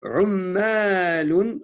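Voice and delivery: slow, deliberate dictation of Arabic words; careful teacher dictation with pauses between words. Shown as a demonstration. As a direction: dictate each word slowly so students can write it. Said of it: "dernière phrase".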